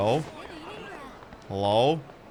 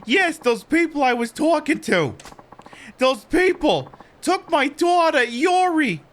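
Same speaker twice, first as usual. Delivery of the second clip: high-pitched mother voice, earnest and upset; distressed mother; 6 dB louder, slower than usual. Acted. Mother telling stranger about her taken daughter, high distressed voice. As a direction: plead your case in a high voice.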